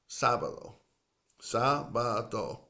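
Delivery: sábado in three clear syllables, slow; SÁ-ba-do, each syllable clear. Shown as a demonstration. Teacher slowing down sábado for learners. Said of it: "Tres sílabas".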